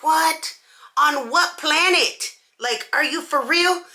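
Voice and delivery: on what planet, really; incredulous. Retold or imitated. Disbelief at being told she lost.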